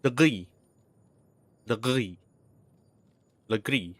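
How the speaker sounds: "gris" with a guttural R and silent S; French-style "gris"; French rolled R. Shown as a demonstration. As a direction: say "gris" as GREE, R in the throat. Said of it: "you need to roll".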